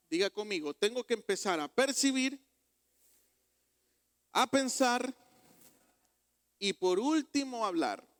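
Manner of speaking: loud, high preacher's cry; fervent pulpit delivery; full-force preaching. Acted. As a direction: drive the teaching home to the church. Preaching the main point.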